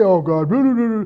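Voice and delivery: mock careless rattled-off prayer; rote prayer. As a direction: toss it off like empty routine. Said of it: "Don't just say".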